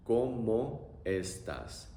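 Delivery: very slow, each syllable of ¿Cómo estás? drawn out; teacher modelling slowly; slow demo. Shown as a demonstration. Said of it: "Pronunciation is also very simple".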